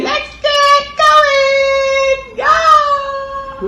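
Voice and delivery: very high, loud excited puppet; squeaky puppet voice. Acted. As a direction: squeal it like an excited cartoon kid. Puppet cheers before the trip.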